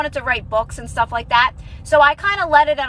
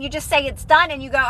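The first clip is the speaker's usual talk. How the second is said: normal talking voice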